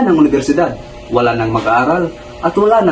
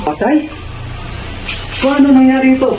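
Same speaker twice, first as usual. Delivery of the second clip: high-pitched stunned question, rising into alarm; shocked disbelief; 10 semitones higher than usual. Acted. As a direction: react as if hit by terrible news. Told that Maria Clara is dead.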